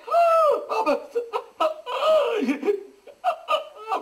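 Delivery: high shriek at the freezing cold; in ice cold water. Real, not acted. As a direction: yelp as ice water hits. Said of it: "ghiaccio di". (strillo acuto per l'acqua gelata)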